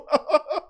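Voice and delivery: short, high laugh; amused laugh. Real, not acted. Host mocking the play action idea, high laugh. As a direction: break up mid-rant.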